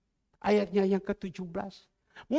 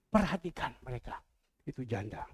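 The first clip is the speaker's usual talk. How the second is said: quiet voice of the Lord; 7 semitones lower, 3 dB quieter than usual. Acted. Pastor voices the Lord telling him to feed widows, low and quiet. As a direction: lower and softer, calm commanding voice. The Lord's voice again.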